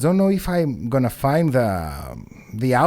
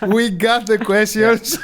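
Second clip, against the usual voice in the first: slow words through laughter; giggling as he says it; laughing; 6 semitones higher, slower than usual. Real, not acted. Joking, laughing.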